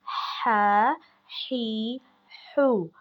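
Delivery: हा ही हू with a strong breath from the throat; breathy ha. Shown as a demonstration. Teacher showing breathy ha to students, light. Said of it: "सांस लेने की आवाज़".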